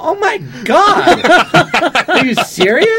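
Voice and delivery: very high, slow and loud, laughing through it; laughing disbelief. Retold or imitated. Hearing about the Tonight Show, high, laughing disbelief. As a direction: drag out the oh my God, laughing in shock.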